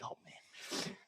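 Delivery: very soft, whispered groan of resignation; retold dismayed aside.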